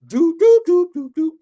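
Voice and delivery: high, loud sung doo-doo ringtone; mock phone ring. Storyteller imitating a big old cell phone, high loud ring.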